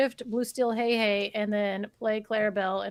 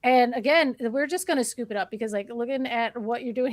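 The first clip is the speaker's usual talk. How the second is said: ordinary talk, nothing marked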